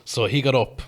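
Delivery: ordinary talk, nothing marked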